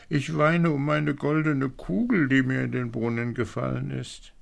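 high-pitched princess lament, no sobbing. A narrator's reading. Princess explains her weeping. (klagende Prinzessin, mit hoher Stimme)

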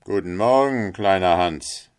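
loud, self-assured greeting; hearty miller. Acted. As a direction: call out a morning greeting, falling at the end. Miller hails little Hans.

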